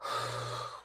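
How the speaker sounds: slow, quiet exhale to settle down; hushed, airy sigh; long calming sigh. Real, not acted. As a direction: breathe out the stress. Calming herself after baby cries.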